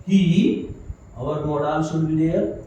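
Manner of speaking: ordinary talk, nothing marked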